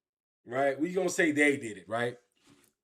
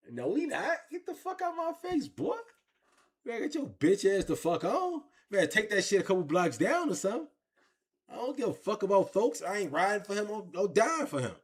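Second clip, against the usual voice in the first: retold angry confrontation; 6 semitones higher than usual. Retold confronting his crew.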